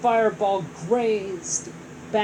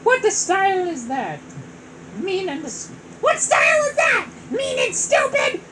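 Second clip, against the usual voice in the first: high, loud squeaky shout; 11 semitones higher, 5 dB louder than usual. Acted. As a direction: squeak it out bravely. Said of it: "Toad squeaked".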